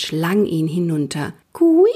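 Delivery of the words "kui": high, a bit louder piping owl cry; owl begging call. A narrator's reading. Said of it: "ließ sie den leisen Bettellaut hören".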